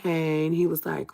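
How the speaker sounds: usual speaking voice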